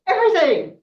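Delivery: high, loud greedy answer; boy demanding every kind of knowledge; eager demand. Acted. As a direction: blurt out that you want it all.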